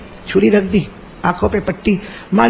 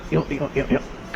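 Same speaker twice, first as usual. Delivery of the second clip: whispered demo; 4 semitones lower, 12 dB quieter than usual. Shown as a demonstration. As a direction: hush it as the hand trembles. Showing the trembling hand.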